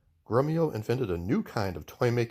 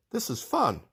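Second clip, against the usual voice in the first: delighted; 6 semitones higher than usual. Acted. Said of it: "he said".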